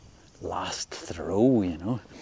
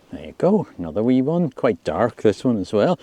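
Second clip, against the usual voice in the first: excited; 3 semitones higher, 8 dB louder than usual. Real, not acted. Another fish landed.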